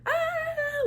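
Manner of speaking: very high mock "ah"; pretend wind-blown cry. Auditioner pretends wind is blowing hard, high pretend cry. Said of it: "pretending like the wind is blowing really hard".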